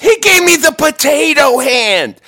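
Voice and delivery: high, loud, drawn-out indignant yell; outraged shout. Acted. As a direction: holler it slowly in comic outrage. Outraged answer to co-host.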